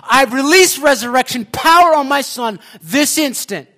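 loud, high declaration, hammering the words; crying out. Retold or imitated. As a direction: cry out a prayer as a command. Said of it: "she's crying out".

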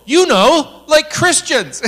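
high, loud comic jab; emphatic retold accusation.

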